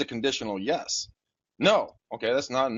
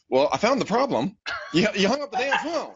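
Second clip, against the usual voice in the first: much higher and louder, mock disbelief; exasperated retort; 11 semitones higher, 4 dB louder than usual. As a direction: tease her for hanging up. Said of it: "I'm like".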